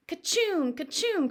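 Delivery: high hammed-up fake sneezes; mock sneezes. Joe fakes Billy's fit, hammed-up sneezes. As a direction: sneeze hard, twice. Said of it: "cried Joe".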